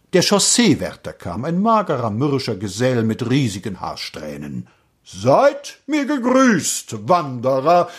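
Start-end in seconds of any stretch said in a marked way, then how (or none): 5.0-7.9 slow, high, ceremonial greeting; solemn. A narrator's reading. Road keeper greeting the wanderer, solemn declamation. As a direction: intone it with grand slowness. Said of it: "sagte er feierlich".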